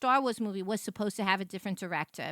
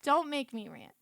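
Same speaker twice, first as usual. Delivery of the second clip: mock warning; 7 semitones higher than usual. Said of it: "I was like".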